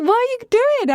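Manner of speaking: put-on startled girlfriend calling out across the café; shocked squeal. Girlfriend spots her on the way to the date, shocked high cry.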